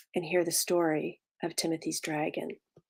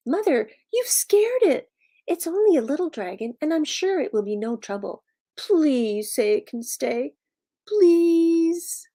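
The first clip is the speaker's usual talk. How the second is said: put-on high boy voice, stretching the pleas; pleading Timothy; 8 semitones higher, 4 dB louder than usual. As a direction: beg like a child who wants a pet. Timothy begs mother to keep dragon.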